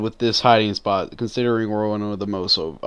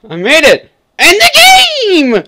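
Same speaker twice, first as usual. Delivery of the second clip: very high, loud victory shriek; triumphant yell; 14 dB louder than usual. Real, not acted. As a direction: scream the win, stretching the last word. Celebrates surviving the round.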